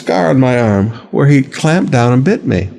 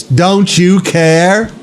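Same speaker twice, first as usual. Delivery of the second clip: mock complaint; 5 semitones higher, much slower than usual. Mock believer complaining to God.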